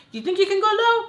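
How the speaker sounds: defiant challenge. Real, not acted. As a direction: throw down the gauntlet.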